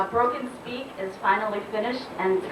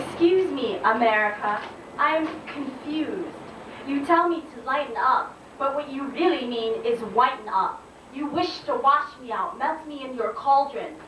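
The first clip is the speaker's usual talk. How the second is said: high-pitched slam poetry delivery; spoken-word poem; 6 semitones higher than usual. Acted. Poet confronts America about losing her color, declaimed spoken-word indignation. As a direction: declaim it in measured, punched phrases.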